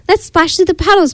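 high, quick storybook excitement; excited cry. A narrator's reading. Piggy urges friends into puddles, quick high excited cry. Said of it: "cried Piggy".

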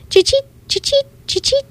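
very high squeaky chirps; cricket chirp. A narrator's reading. The cricket rubbing its legs, very high chirps. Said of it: "rubbing his hind legs".

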